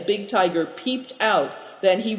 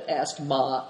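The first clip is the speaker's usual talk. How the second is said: ordinary delivery, nothing marked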